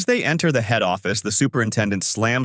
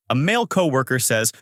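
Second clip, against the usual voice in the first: ordinary delivery, nothing marked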